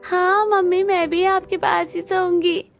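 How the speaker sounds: put-on high little girl voice, eager; the girl joins in wanting mummy. Girl insists on sleeping with mummy. (ऊँची नन्ही बच्ची की आवाज़)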